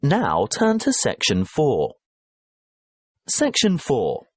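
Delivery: normal talking voice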